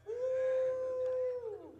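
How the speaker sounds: high whooping shout; a joyful whoop in the pulpit; preacher's whoop. Shown as a demonstration. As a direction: let out a high preacher's woo.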